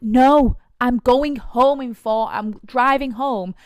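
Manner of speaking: turning down the man outside the club, loud and exasperated; exasperated rant. Retold or imitated. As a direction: spell it out to him, fed up and loud. Refusing a pushy man, exasperated.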